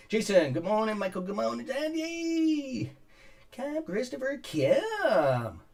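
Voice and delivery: high lilting roll call of names; sing-song greetings. Real, not acted.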